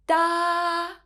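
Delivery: "Da" held high and level; high flat tone. Shown as a demonstration. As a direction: draw out "Da" on one high, even note. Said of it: "is a high flat tone. It sounds like this".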